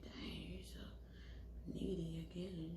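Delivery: hushed mock complaint; muttered whisper. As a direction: whisper it in irritation. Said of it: "I'm like".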